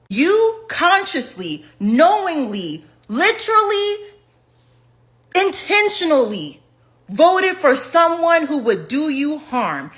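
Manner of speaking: each accusing word weighted, slow; slow pointed accusations to voters; slow emphatic list. Real, not acted. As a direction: hammer every word, slowly.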